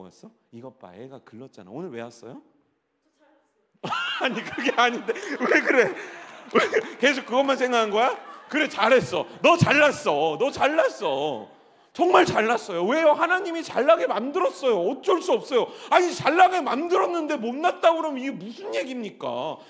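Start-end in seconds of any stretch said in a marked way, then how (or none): 3.8-8.2 loud laughing disbelief; laughing protest. Real, not acted. Laughing at a member's answer. 8.5-13.1 loud, emphatic praise; loud affirmation. Real, not acted. Shouted affirmation to member. 13.1-19.6 high, loud laughing preaching; fiery preaching. Acted. Preaching at full force.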